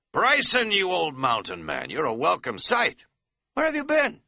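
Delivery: high, loud folksy hail; Katz's hearty greeting. Acted. Katz hailing Bryson on the trail, loud and folksy. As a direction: greet your friend with relief on the trail.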